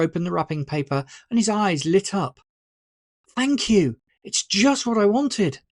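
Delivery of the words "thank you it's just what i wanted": beaming thanks. A narrator's reading. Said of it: "with a beaming smile".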